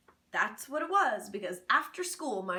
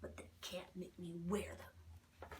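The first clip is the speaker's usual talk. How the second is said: defiant whisper; 4 semitones lower, 12 dB quieter, slower than usual. A narrator's reading. Defiant aside.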